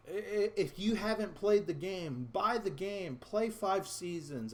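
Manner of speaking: high, insistent challenge; frustrated. Real, not acted. Gamer challenging viewers who haven't played, high and frustrated. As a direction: press the point with exasperation. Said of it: "is so frustrating".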